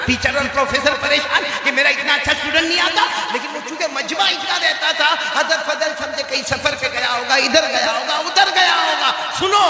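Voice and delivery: impassioned preacher pitch; fiery preaching. Acted. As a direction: rouse the crowd, rising to a cry. Preacher builds to full force.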